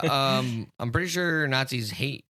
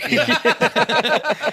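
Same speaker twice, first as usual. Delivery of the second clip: ordinary talk, nothing marked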